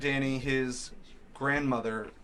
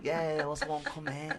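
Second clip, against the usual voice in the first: put-on Philly accent; 3 semitones higher than usual. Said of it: "I can't even do it".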